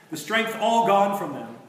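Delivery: usual speaking voice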